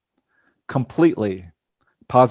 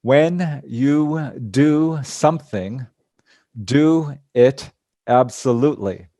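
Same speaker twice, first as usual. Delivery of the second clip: slow, drawn-out, each word set apart; very slowly; slower than usual. Retold or imitated. Wise old man speaking his riddle, very slowly. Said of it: "very slowly".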